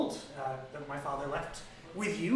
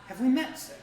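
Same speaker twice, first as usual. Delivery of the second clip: higher, louder startled nobleman; Rainier startled; 9 semitones higher, 6 dB louder than usual. Acted. Rainier meets the party at the vault, startled and loud. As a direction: blurt it out, caught off guard. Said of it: "he's like".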